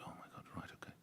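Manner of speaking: hushed, voiceless shock; stunned whisper. Retold or imitated. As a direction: whisper it, floored. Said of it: "I said".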